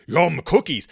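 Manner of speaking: loud mock Cookie Monster; a hint of Cookie Monster, hammed up; imitating Papa Bear's greedy line. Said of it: "That is a cookie monster thing to say".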